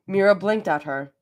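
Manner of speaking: usual speaking voice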